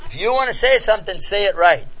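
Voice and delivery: retold exasperated demand; scolding demand. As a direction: lay down the law to a bumbling suitor. Retold woman scolds clumsy suitor.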